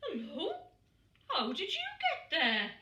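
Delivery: high, surprised, tender voice; delighted polar bear. A narrator's reading. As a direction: marvel at the chick in his hat. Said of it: "he said".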